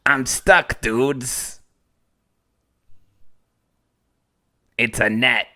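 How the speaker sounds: squeaky little mushroom voice; Toad voice. Acted. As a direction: pinch it up like Toad.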